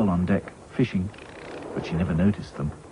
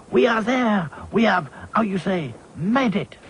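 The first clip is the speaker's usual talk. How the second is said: put-on high French-accented English; 10 semitones higher than usual. A narrator's reading. Claude announces their arrival in French accent. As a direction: hunt for the English words. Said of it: "cried Claude".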